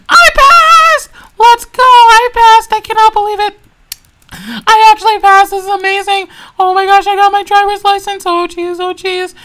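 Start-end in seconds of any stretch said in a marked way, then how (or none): 0.1-8.3 loud, high triumphant shouting; ecstatic cheer. Acted. [name] celebrating passing the exam, ecstatic shouting. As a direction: shout it out in joy.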